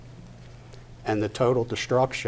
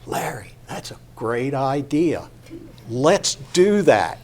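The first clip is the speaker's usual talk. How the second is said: lightly voiced eager body part, building louder; 3 semitones higher, 4 dB louder than usual. Body part talking to Larry about stepping off, eager. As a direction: cheer on the plan, stretching it out louder at the end. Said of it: "part of my body will say".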